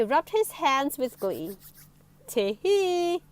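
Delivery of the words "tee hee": gleeful higher chortle; a sly little laugh to himself; chortling. A narrator's reading. Mister Happy chortling over his plan. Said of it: "he chortled to himself".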